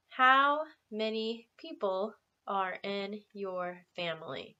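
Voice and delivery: slow, clear teacher modelling; slow model question. Shown as a demonstration. Teacher modelling a question slowly for students. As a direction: spell it out slowly for learners. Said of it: "repeat that with me".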